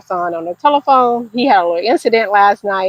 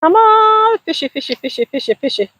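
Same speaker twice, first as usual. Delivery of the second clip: coaxing sing-song; 10 semitones higher than usual. Real, not acted. Coaxing a fish to bite.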